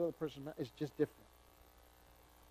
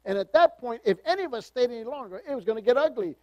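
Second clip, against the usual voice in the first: louder, higher preaching; building preaching; 5 semitones higher, 7 dB louder than usual. Acted. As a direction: drive the point home.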